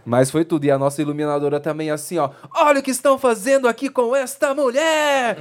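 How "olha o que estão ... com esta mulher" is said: high indignant cry; retold yell. Lighting tech yelling about the woman's search, high cry. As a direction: shout it out to the whole airport, pitched high. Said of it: "E ela gritando".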